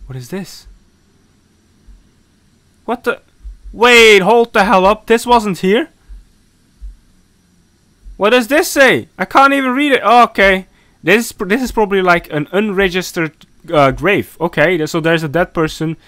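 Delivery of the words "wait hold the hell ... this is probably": ordinary talk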